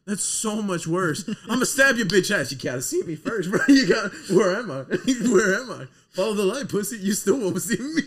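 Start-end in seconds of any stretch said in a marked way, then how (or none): 1.5-8.0 mock taunting tough guy. Friend taunting cop, imitated.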